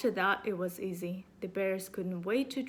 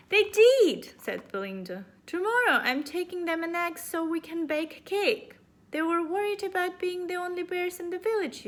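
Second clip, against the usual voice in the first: put-on high girl voice; 9 semitones higher, 7 dB louder than usual.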